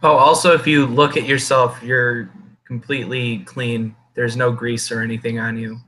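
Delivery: ordinary talk, nothing marked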